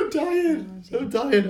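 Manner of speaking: high, weak, nearly crying with laughter; helpless with laughter. Real, not acted. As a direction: squeak it out, worn out from laughing. Recovering from a laughing fit.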